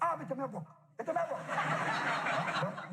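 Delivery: high, slow mock Chinese accent greeting; sing-song Chinese accent, stretching Mister Melbrook; mock Chinese waiter. Chinese waiter greeting him in Chinese accent. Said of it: "Chinese restaurant".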